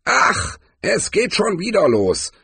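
put-on high old-warrior voice, exasperated sigh; high-pitched sighed complaint. A narrator's reading. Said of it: "seufzte Cohen".

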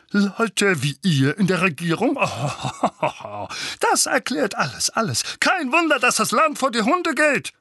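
high, loud scornful rant with a bitter laugh; polternde Empörung. A narrator's reading. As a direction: rant in outrage, climbing to a shout. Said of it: "brüllte Onkel Vernon". (laut und höhnisch polternd)

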